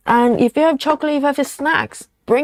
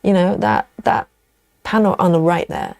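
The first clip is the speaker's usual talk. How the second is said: usual speaking voice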